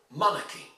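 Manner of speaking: MON-archy, stress on the start of "monarchy"; first-syllable stress. Shown as a demonstration. Teacher models for students, "monarchy" stressed on the first syllable. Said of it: "you need to stress on the first syllable".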